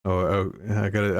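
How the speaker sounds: usual speaking voice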